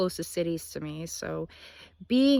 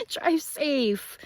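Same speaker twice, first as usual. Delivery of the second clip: cheering; 8 semitones higher, 3 dB louder than usual. Retold or imitated. The entire crowd's reaction was just to start cheering.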